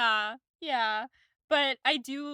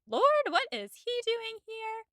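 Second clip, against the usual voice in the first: much higher, playful fake alarm; teasing mock alarm; 12 semitones higher than usual. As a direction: squeal it like a flirt pretending to be shocked. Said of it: "she starts joking around".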